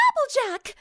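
high, urgent call across the clearing; shouting a name. Acted. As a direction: cry out her name in alarm. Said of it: "Rarity shouted".

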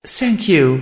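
choked through tears. A narrator's reading. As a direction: squeeze it out while crying. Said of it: "Twilight choked out through the tears".

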